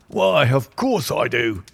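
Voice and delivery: wheezy, slow inspector voice; wheezing. A narrator's reading. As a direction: wheeze it out slowly. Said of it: "he wheezed".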